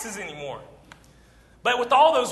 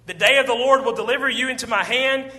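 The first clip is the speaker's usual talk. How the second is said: bold declaration; 4 semitones higher, 6 dB louder than usual. Acted. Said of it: "David said to the Philistine".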